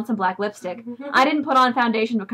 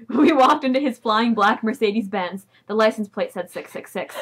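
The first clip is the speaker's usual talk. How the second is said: normal talking voice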